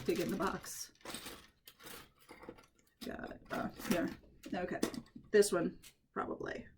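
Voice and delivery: usual speaking voice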